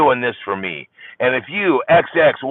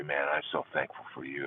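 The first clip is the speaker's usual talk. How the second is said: much softer, tender thanks; 8 semitones lower, 13 dB quieter than usual. Pretend. A father hugging his daughter, soft and tender. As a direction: murmur your thanks close to her. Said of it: "I say".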